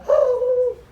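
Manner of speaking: high, loud, held "ah"; mock alarmed cry. Teen realizing the trouble with mother, mock high wail. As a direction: imitate a long high wail of dread. Retold alarm at own outburst.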